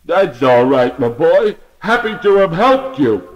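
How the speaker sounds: a hint of shivering cold; frozen voice. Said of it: "answered in a very frozen voice".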